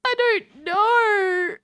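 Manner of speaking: drawn-out high whimper of confusion; slow, high, whimpering I don't know; mock whine. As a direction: whine it like a lost child. Overwhelmed by unknown quiz options.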